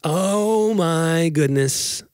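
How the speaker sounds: ordinary talk, nothing marked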